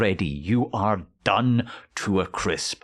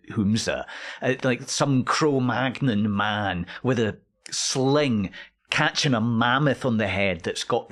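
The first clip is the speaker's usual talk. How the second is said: ordinary talk, nothing marked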